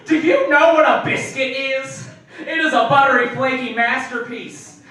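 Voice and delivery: loud, high comic rant; worked-up, over-the-top lecture; indignant tirade. Retold or imitated. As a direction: rant like a patriot defending their food. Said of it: "I went on this tirade".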